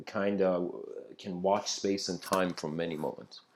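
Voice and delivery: ordinary delivery, nothing marked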